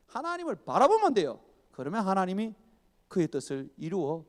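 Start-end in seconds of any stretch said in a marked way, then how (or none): 0.1-1.4 emphatic repeat. Shown as a demonstration. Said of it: "repeated phrase".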